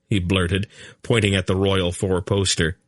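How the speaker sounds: plain reading voice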